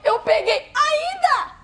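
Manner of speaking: high, exasperated mock outburst; retold indignant husband. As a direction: act out a husband's indignant challenge. (marido indignado, imitado em tom agudo)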